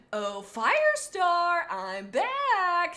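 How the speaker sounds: high, slow, sing-song and delighted; happy villain greeting. Acted. Chaser greets Firestar, high, slow and gleeful. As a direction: croon her name like a gleeful ghost. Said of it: "said Chaser happily".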